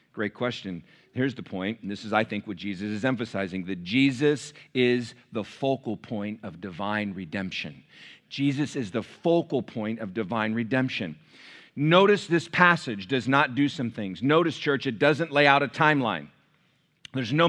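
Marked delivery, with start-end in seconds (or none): 0.1-11.2 low, soft, weighty delivery; solemn preaching. Real, not acted. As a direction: lay down the main point low and measured. Preacher gives the central point.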